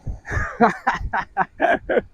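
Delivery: wheezy laugh at his own joke; breathless laugh. Real, not acted. Laughing at his own duck joke, breathless wheezy laugh. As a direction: laugh through the air, no voice.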